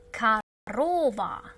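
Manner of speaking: slowed, clear repeat; slow model word. Shown as a demonstration. Teacher repeating the word slowly for learners. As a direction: say it slowly again. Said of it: "The first O is not stressed".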